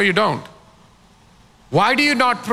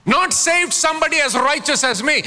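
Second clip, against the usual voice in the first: ordinary delivery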